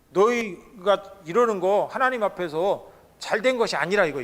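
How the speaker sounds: usual speaking voice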